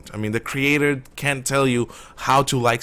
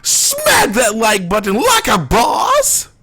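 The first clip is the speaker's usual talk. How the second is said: high, slow, hyped shout; YouTuber shout; 10 semitones higher, 7 dB louder, much slower than usual. Retold or imitated. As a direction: yell it like a hype YouTuber. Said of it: "I'm gonna copy Jake's advocate".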